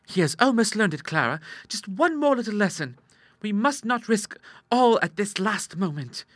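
put-on high-pitched, coaxing young girl; scheming sister Ida. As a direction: coax her in a high voice.

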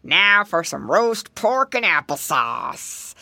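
slow, low, menacing gator voice; big bad creature savouring his prey; gloating alligator. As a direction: draw it out like a hungry villain. Alligator gloats over caught piglet.

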